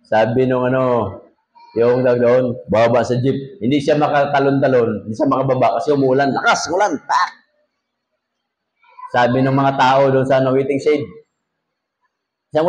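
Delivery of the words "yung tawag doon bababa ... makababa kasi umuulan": normal talking voice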